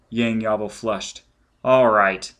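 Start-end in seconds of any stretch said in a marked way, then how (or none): 1.6-2.3 a sudden louder burst; blurted. A narrator's reading. Embarrassed officer blurting at teasing crew, loud. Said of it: "he blurted".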